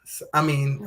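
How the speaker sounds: usual speaking voice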